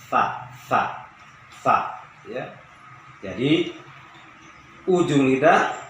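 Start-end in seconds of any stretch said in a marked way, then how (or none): 0.1-2.0 airy fa, fa, fa with the breath flowing out; breathy fa sound. Shown as a demonstration. Teacher demonstrating a breathy fa to students. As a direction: let the air hiss out through lip and teeth on fa. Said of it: "Dari ujung lidah dan ujung gigi atas".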